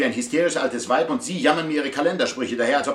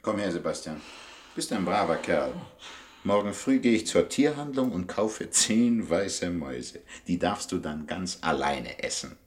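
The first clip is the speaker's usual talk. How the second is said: tender coaxing; 6 semitones lower than usual. Acted. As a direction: soothe the cat like a good boy. Owner coos to his cat.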